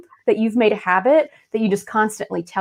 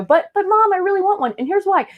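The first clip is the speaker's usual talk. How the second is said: put-on much higher, whiny kid voice; pleading child; 10 semitones higher, faster than usual. Child begging mom for a pony, whiny. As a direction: beg like a kid who wants a pony.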